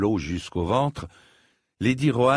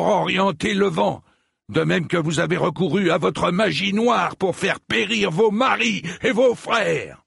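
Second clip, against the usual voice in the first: put-on high, furious old knight; bawled accusation; 9 semitones higher, 3 dB louder than usual. A narrator's reading. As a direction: hurl the accusation, building to an outcry. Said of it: "brailla sir Eustace". (accusation braillée avec colère)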